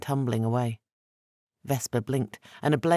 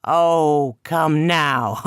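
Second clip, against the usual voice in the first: much louder, teasing trickster; mock-hurt tease; 3 semitones higher, 9 dB louder, much slower than usual. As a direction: brush off the charge playfully. Eshu teasing.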